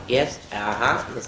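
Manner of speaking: ordinary delivery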